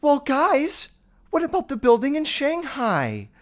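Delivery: hammed-up high-pitched interjection; mocking clueless someone. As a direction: pipe up high like an oblivious objector. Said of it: "then someone's like".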